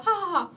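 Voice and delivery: high "ha ha" with noisy in-breath; demonstrated own laugh. Shown as a demonstration. Showing her friend how she laughs, demo laugh. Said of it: "skrattar jag så här".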